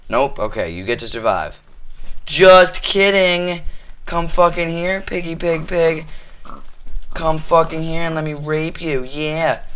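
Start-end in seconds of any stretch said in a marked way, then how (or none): 2.2-3.6 slow, loud, high mocking yell; loud taunt. Real, not acted. Gamer pretending to spare a pig, loud slow taunt. Mock reprieve then attack. 4.1-9.6 high, menacing taunt; taunting chase. Real, not acted. Player chasing a pig to kill it, high taunting voice.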